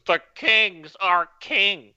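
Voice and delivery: slow, nasal nerdy announcer impression; nasally nerd voice. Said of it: "It's like my nerd voice. ... my nasally nerd voice".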